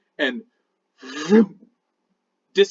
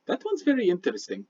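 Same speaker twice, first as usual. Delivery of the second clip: soft, musing mystic aside; lightly voiced Midnight; 4 semitones lower, 8 dB quieter, faster than usual. Midnight leaning on his staff, musing about a name. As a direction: muse quietly to yourself. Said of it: "Midnight's like".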